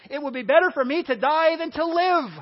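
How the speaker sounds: dramatic outburst. Acted. Preacher voicing Jonah who wanted to die, dramatic outburst. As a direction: proclaim it like a worked-up, sulking prophet.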